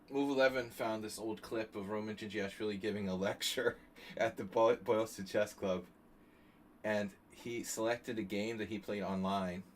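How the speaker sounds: normal talking voice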